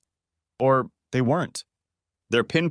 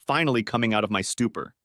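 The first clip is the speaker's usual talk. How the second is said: ordinary delivery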